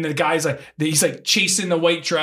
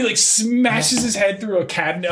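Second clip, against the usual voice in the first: ordinary talk, nothing marked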